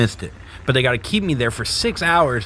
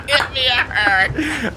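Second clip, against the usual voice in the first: much higher, laughing through the line; laughing repeat; 13 semitones higher than usual. Retold or imitated. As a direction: crack up while repeating it. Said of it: "once they gave me that Demerol".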